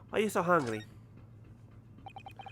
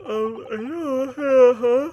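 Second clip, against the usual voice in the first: stretched, loud, much higher fake cry; mock wail; 11 semitones higher, 15 dB louder, much slower than usual. Real, not acted. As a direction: wail it out, slowly and hammed-up. Overwhelmed by rude sailor.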